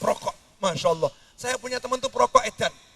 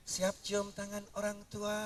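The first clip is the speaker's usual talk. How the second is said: softer, timid mock delivery; meek version; 17 dB quieter than usual. Shown as a demonstration. Preacher shows how a Betawi would NOT say it, meek and soft. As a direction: say it limply, no Betawi fire. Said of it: "orang Betawi ngomongnya".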